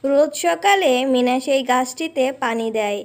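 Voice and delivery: even storyteller's narration voice; story narration. A narrator's reading. Narrator tells children how Meena sets off to play, in storyteller voice. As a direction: narrate the scene calmly for children. Narrator between cartoon dialogue.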